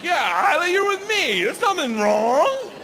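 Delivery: high, loud, over-bright reassurance; hammed-up cheerful dad; overeager dad. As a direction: jump in too eager.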